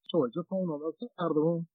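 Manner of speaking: distant voice on a phone line; far away. Real, not acted. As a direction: sounds like from across the room. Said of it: "you sound so far away".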